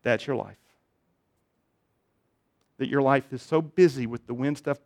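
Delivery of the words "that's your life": hushed and soft, much softer; the small quiet voice inside; little inner voice. Retold or imitated. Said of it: "I hear this little voice say".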